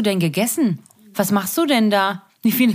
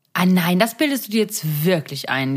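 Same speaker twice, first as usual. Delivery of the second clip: normal talking voice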